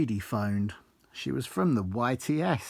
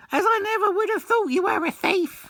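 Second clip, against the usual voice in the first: very high, much louder shocked squeal; mimicking Charlotte, shocked; 7 dB louder than usual. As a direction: shriek it like a scandalised boss. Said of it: "she said".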